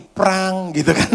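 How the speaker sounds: a slow, clanging prang; car door clang. Retold or imitated. Mimicking an old car door slamming, slow clang. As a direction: bang it shut like an old car.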